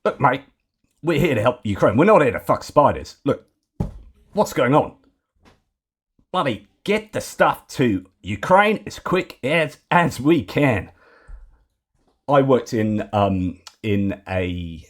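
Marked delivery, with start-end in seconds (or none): 0.0-10.9 higher-pitched, hammed-up Aussie accent; put-on Australian accent. Host urges help for Ukraine in a hammed-up Australian accent. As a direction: play a broad mock Australian, voice pushed up. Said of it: "I'm gonna hit you with my Australian accent".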